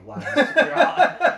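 high, loud and drawn out through laughter; laughing. Real, not acted. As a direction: stretch it out, cracking up. Laughing at the absurd book.

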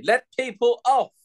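high, loud shout at commuters. Retold or imitated. Commuter on the London train shouting at boarders, loud and high. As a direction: bark it at the crowd blocking the doors. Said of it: "I've started to shout quite loudly".

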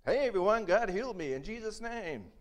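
high, loud shout to the crowd; calling out. Acted. Healed beggar shouts to the temple crowd, high and loud. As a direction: announce it to everyone.

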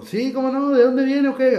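lightly voiced friendly cabbie making small talk; the old driver chatting up his passenger, light imitation; chatty old driver.

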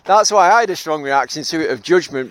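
ordinary delivery

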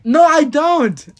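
loud, high, drawn-out protest; indignant denial. Real, not acted. Denies having a bigger forehead.

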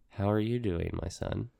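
ordinary talk, nothing marked

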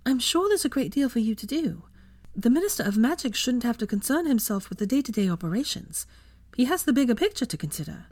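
butter up the minister gently; flattering Hermione. A narrator's reading. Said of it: "in a conciliatory fashion".

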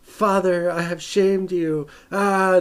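over-the-top melodramatic lament; mock freak-out. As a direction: ham up a wounded hero's despair. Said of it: "like, he just freaks out".

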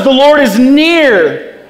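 high, slow, projected refrain; ringing proclamation. Real, not acted. Preacher giving the refrain for trouble at work, proclaiming. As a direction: proclaim it with full conviction.

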